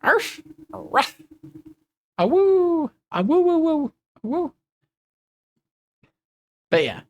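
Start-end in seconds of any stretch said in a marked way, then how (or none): 0.0-1.2 high little arf; puppy yip. Pretend. Puppy streamer yipping. 2.2-4.5 high puppy howl; howling like a wolf pup. Pretend.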